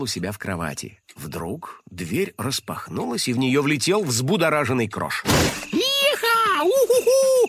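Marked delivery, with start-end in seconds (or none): 5.7-7.4 very high joyful whoops. A narrator's reading. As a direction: whoop with joy. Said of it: "подпрыгивал он на месте от радости".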